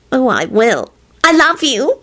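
put-on high, adoring girlfriend coo. A narrator's reading. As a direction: coo it lovingly to your sweetheart. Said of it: "she cooed".

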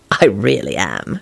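low, slow, grave reply; serious. A narrator's reading. Lupin admitting he is horrible, seriously. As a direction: drop the pitch and mean it. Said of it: "he replied seriously".

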